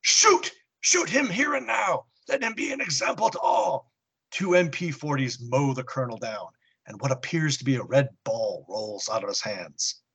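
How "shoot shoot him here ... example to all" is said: high furious shout; berserk. Acted. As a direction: scream the order in rage. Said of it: "Hitler goes berserk".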